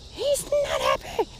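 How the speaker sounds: squeaky high voice for the fish, slowed; mock high voice. Second take in put-on high voice.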